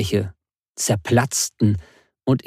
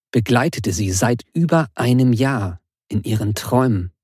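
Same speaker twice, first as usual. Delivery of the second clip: ordinary delivery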